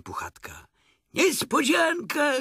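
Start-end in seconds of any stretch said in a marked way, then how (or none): 1.1-2.3 high, loud, drawn-out surprise cry; very loud shout. A narrator's reading. Friends greeting Pooh on the doorstep, loud shout. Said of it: "krzyknęli bardzo głośno".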